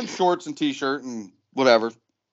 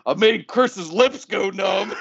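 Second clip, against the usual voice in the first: high, slow imitation of a tickled confession; amused confession; 6 semitones higher, slower than usual. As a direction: play him barely holding back laughter. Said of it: "he starts laughing again".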